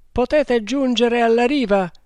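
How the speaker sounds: high loud call across water. A narrator's reading. Agur calls to the drowning man, loud high call. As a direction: call out to him in the river.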